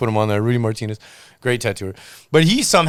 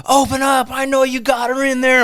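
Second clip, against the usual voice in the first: high, raised-voice imitation of an angry wife; retold yelling; 10 semitones higher, 4 dB louder than usual. Wife banging on the shop door, mock yell. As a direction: imitate someone yelling through a locked door. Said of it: "she's yelling, banging on the door".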